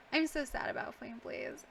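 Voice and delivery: ordinary talk, nothing marked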